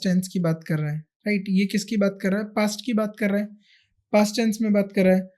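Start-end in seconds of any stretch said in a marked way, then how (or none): none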